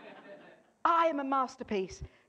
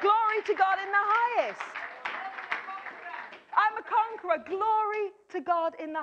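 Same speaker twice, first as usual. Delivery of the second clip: exultant proclamation; 7 semitones higher than usual. Real, not acted. Preacher proclaims praise to God, exultant. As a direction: lift it up in praise.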